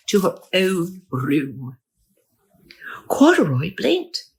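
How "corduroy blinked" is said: ordinary delivery, nothing marked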